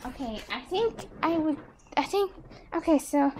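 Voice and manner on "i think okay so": normal talking voice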